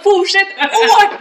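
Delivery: slow, high, loud laughing command; mock panic shout. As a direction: yell at him to drive, half laughing. Ordering friend to drive on.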